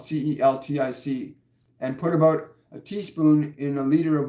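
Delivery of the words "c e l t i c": C E L T I C, each letter said separately; spelled out. Shown as a demonstration. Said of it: "Celtic sea salt".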